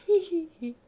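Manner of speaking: high little "hee hee hee"; mock giggle. She's just lying there laughing, mock giggle. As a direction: imitate a coy giggle.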